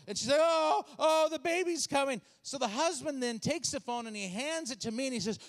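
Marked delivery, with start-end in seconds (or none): 0.4-2.2 acted labor distress. Woman in labor announces the birth.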